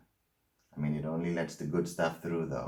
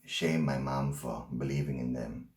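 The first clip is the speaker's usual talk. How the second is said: ordinary talk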